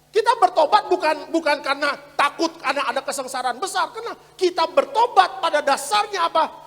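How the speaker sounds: loud, high, emphatic preacher; preaching at full force. Real, not acted. Pastor explaining why we repent, preached at full force. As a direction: throw the question out to the congregation.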